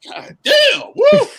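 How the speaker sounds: high, loud mock-emotional outburst; overwhelmed shout. Kid overwhelmed by the sad episode, high loud outburst. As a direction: let it burst out, overcome. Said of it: "And then I was like".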